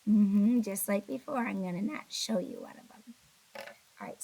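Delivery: ordinary delivery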